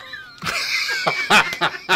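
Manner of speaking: loud squealing laugh; big high laugh. Real, not acted. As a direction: burst out laughing at your own joke. Laughing at own punchline.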